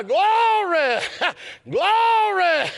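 high, drawn-out cry of glory; shouted praise. Real, not acted. Preacher praising victory over death, shouted praise. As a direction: let "glory" ring out high and long.

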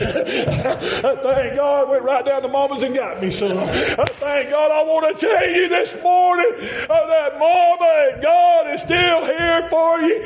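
high, drawn-out preacher's shout; full-force preaching. Real, not acted. Preacher urging the congregation to call for help, full-force preaching. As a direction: build into a high, chanting cry and hold it.

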